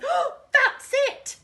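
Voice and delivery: high, loud delighted cry with a laugh; thrilled shout, laughing; excited shout. Acted. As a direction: burst out with a sudden idea. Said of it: "shouted Tiger".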